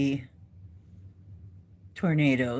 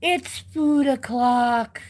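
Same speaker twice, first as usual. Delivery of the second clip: cat voice slow and drawn out; 8 semitones higher, slower than usual. Retold or imitated.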